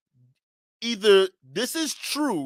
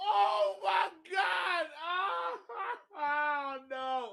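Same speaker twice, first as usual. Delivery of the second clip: high, slow, laughing cries; helpless laughter; 10 semitones higher, 10 dB quieter, much slower than usual. Real, not acted. Streamer breaking down laughing at the clip. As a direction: wail it through laughter.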